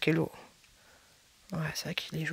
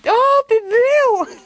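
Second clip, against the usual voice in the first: long, drawn-out high squeal, much louder; gushing squeal; 9 dB louder, much slower than usual. Real, not acted. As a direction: squeal with delight at something adorable. Gushing over a cute product. (cri aigu et ravi, très étiré)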